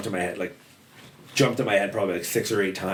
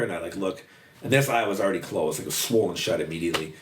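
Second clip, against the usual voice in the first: usual speaking voice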